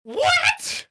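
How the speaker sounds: squeaked, very high and loud; shocked what. Real, not acted. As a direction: total disbelief. Shocked reaction.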